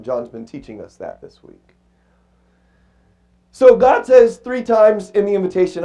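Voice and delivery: usual speaking voice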